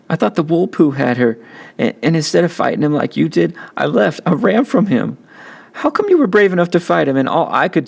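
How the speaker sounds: high, breaking tearful voice; sobbing confession. A narrator's reading. Kenny sobbing to Byron about leaving Joey. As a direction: confess while crying hard.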